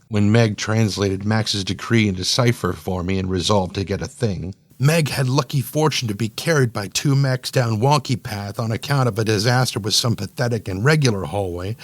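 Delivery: plain reading voice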